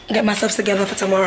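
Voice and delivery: usual speaking voice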